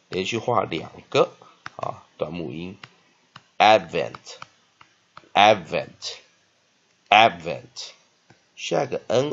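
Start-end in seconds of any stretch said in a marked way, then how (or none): 3.6-7.9 "Advent" slow, both AD and VENT stressed; drawn-out, loud "Advent", weight on each syllable; double stress. Shown as a demonstration. Teacher drilling a two-stress word, "Advent" slowly with both syllables stressed. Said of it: "两个音节却有双重音".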